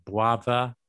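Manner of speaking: "brother" with the R said as a W; slow Cockney "brother", starting like "bw". Shown as a demonstration. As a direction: round the R of "brother" into a W. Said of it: "the R sometimes becomes a W".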